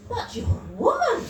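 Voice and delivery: mocking man's voice. Clueless man telling comedian she's a woman, in a high mock voice. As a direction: sneer it like a clueless bloke. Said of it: "he'll say".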